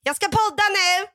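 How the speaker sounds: high, loud excited declaration; mock proud announcement. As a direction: imitate a friend announcing it gleefully. Imitates friend's proud announcement.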